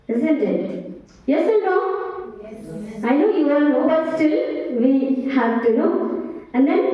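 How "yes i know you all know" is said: ordinary talk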